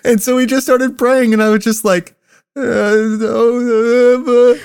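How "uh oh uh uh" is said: mock sobbing. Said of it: "about to start fucking crying".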